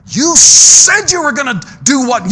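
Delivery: slow, loud, rising challenge; pressing question. Acted. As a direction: drag it out and demand the answer. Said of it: "preacher pressing the congregation".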